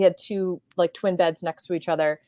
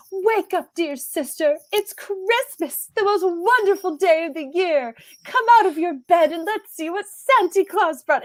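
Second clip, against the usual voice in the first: mock cheery wake-up call; 10 semitones higher, 4 dB louder than usual. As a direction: chirp it out like an overexcited little brother. Brother's cheerful Christmas wake-up.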